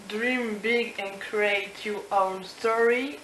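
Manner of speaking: slow English with a heavy French accent; reading an English slogan aloud in a French accent. Real, not acted. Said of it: "avec mon accent génial".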